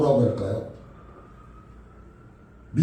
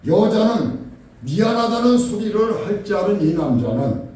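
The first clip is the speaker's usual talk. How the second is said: ordinary talk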